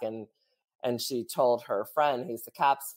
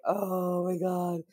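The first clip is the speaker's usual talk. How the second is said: slow, higher mock-awed oh my God; a hint of fangirl awe; fan gasp; 6 semitones higher, much slower than usual. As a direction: imitate starstruck fans swooning. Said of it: "the fans who listen ... gonna be like".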